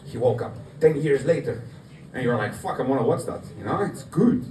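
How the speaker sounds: ordinary talk, nothing marked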